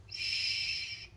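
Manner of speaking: geflüstert, hier: whispered shh, like keeping a secret from the fans; hushing whisper. Real, not acted. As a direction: breathe a soft shh to hush the listeners.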